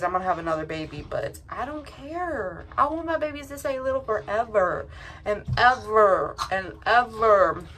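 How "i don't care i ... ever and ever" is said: sing-song wish. Real, not acted. Mom wishing her babies stay little.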